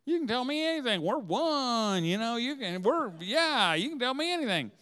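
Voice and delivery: high, incredulous pleading; mock surprised protest. Husband protesting to his wife, high and surprised. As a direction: act shocked she won't confide. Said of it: "I'm like".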